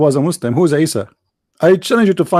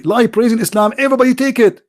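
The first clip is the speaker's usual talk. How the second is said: usual speaking voice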